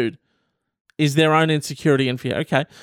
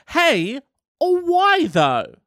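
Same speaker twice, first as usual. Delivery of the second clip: high why; 8 semitones higher, much slower than usual. Pretend. Annoyed questioning.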